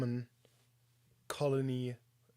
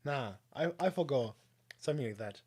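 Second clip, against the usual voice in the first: ordinary talk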